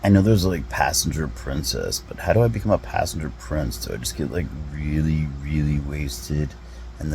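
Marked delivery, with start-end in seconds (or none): none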